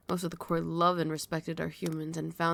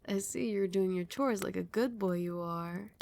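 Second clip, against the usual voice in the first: usual speaking voice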